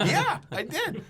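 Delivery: high, slow, loud "Yeah, I did"; laughing admission. Retold or imitated. Admitting the thought to the crowd, a laughing high admission. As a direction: land it with a laugh. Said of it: "punchline answer".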